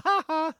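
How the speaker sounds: high mocking "ha, ha"; Nelson Muntz. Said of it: "he's having the last laugh".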